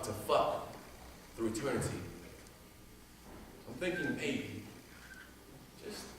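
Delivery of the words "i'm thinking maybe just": much softer, almost whispered musing; hushed reflection. Acted. Poet musing to the crowd, hushed and softer. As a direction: drop to a hush, thinking aloud. Poem's quiet reflective turn.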